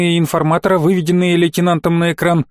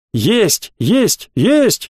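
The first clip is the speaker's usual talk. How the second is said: crew acknowledgements, each higher than the last; one clipped reply after another, climbing in pitch; echoing acknowledgements; 5 semitones higher, much slower than usual. A narrator's reading. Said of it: "эхом понеслось по каналу связи". (отклики эхом, каждый выше предыдущего)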